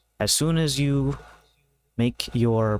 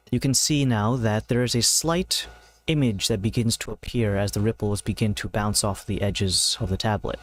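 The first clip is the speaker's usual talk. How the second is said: usual speaking voice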